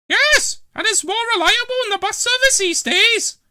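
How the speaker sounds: put-on very high, loud old lady snapping back; tart retort. Dillis retorting to Trevor from the bed push, tart. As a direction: put him in his place. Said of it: "retorted Dillis".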